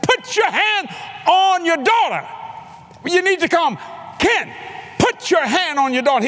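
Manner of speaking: very high, urgent preacher's holler; re-enacted desperate yelling; hollered command. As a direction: shout instructions to someone who can't take them in. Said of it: "I had to holler at him".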